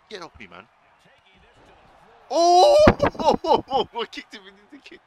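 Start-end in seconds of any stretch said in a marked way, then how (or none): 2.3-5.0 high, slow laughing squeal; real loud laughter; laughing hard. Real, not acted. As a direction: laugh through the words, loud and stretched out. Laughing at game moment.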